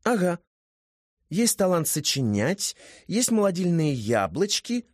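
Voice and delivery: put-on high, chatty girl voice; a lively young woman; high female voice. A narrator's reading.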